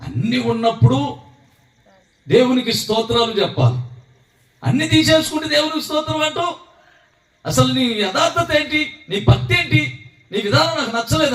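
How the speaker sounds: mock the husband's praise, a bit slowed; scolding wife. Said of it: "భార్య ఉరుకుతా వచ్చిందంట". (భర్తను ఎగతాళి చేస్తూ తిట్టే భార్య, ఎత్తైన స్వరంతో)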